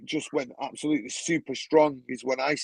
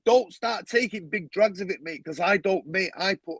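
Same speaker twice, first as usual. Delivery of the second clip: ordinary delivery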